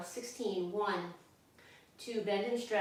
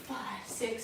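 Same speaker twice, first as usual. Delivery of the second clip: ordinary talk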